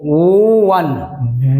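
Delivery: ओ वन stretched out very slowly, lips closing on वन; drawn-out demo. Shown as a demonstration. Teacher showing lips closing on one. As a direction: draw out ओ वन so the lips are heard meeting. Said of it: "देखिए वन वो बोल रहे हैं ना".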